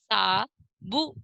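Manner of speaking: साबुन stretched slowly; slow first take. Shown as a demonstration. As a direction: sound out साबुन. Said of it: "इनको हम पढ़ेंगे।".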